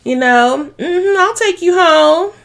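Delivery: high, fake-surprised gush; mock shocked. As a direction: overplay the surprise so it rings false. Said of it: "Paris acting shocked".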